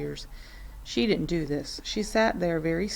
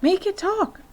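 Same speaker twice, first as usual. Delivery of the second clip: put-on much higher, eager little girl; 13 semitones higher, 5 dB louder than usual. A narrator's reading. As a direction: beg the bird to speak like an impatient child. Matilda asks eagerly.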